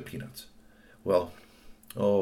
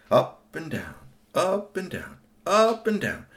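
building up and down; 9 semitones higher, 4 dB louder, slower than usual. A narrator's reading. Toy bouncing away.

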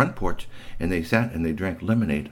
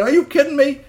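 incredulous; 6 dB louder than usual. Acted. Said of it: "Bigfoot said".

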